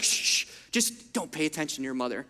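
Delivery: grandpa hushing. Retold or imitated. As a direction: shush like a sly old grandfather. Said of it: "And he would be like".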